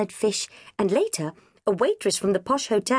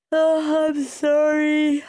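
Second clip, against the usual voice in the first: yawned; 9 semitones higher, 4 dB louder, much slower than usual. A narrator's reading. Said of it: "I yawned".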